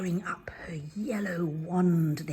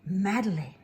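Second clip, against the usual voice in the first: ordinary delivery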